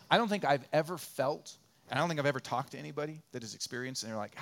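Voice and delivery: ordinary talk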